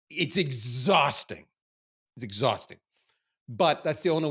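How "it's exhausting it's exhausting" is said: drawn-out, slowed way down; slow weary repeat. Real, not acted. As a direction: let it drag out of you. Weary repeat of the same words.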